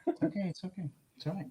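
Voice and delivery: soft, high murmur to a pet; soothing the dog. Real, not acted. Owner settling frightened dog, soft high murmur.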